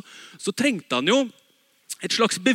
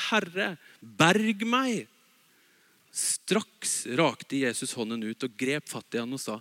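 scripture reading; 7 semitones lower than usual. Acted. Reads scripture aloud. (lav, rolig bibellesning)

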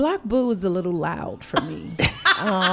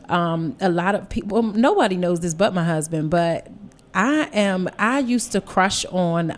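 ordinary delivery

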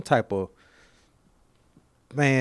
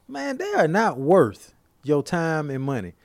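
ordinary talk

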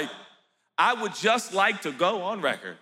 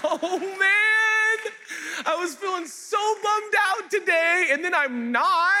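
overjoyed gushing; 10 semitones higher, slower than usual. Acted. Believer overjoyed that God always listens, gushing. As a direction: gush with childlike delight.

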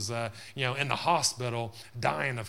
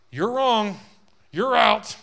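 higher, loud verdict like a stern judge; mock harsh judge; 9 semitones higher, 11 dB louder, much slower than usual. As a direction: bark out the verdict in mock judgment. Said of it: "Not looking to say".

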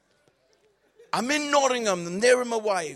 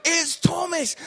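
punchline reveal; 8 semitones higher, 7 dB louder than usual. Retold or imitated. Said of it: "it's an obvious gag".

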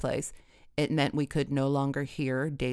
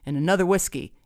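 mildly defiant, punched louder; 3 semitones higher, 6 dB louder, faster than usual. A narrator's reading. Dad orders another whiskey at dinner, defiant. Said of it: "Dad said defiantly".

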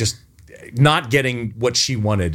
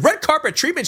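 incredulous echo. Retold or imitated. Disbelief at casting assistant's words.

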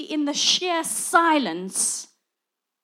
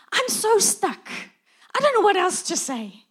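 high, pitched-up flustered panic; mock panic; 6 semitones higher, 3 dB louder than usual. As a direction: play up being stuck for words. Retelling panic in awkward small talk.

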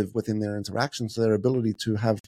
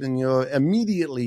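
ordinary talk, nothing marked

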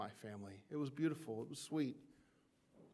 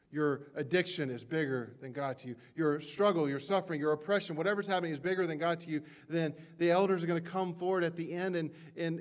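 louder and higher, building to a passionate cry; impassioned sermon peak; preaching at full force; 4 semitones higher, 6 dB louder than usual. Acted. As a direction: preach it out, swelling with each phrase.

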